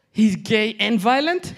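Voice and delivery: high, loud, slow disbelief; shocked question. Retold or imitated. Mom misunderstanding kinky, loud shocked disbelief. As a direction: ask slowly, pitch climbing in alarm.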